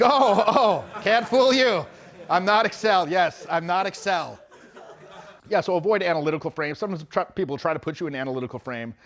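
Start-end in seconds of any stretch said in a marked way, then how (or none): 0.0-4.4 mock sarcasm. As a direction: tease her back in a put-on sneer. German girl mocked in retelling.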